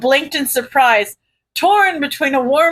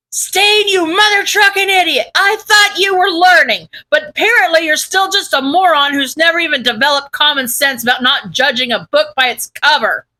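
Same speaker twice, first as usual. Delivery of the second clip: shouting taunt; 4 semitones higher than usual. Acted. Taunting villain over loudspeaker.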